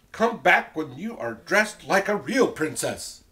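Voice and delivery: high, loud, haughty telling-off; scolding Prince Ronald. Acted. Prince Ronald scolds messy Elizabeth, loud and disgusted.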